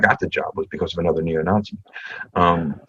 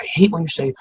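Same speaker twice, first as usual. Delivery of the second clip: Keith's voice; 9 semitones higher, faster than usual. Retold or imitated. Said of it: "his very Keith way".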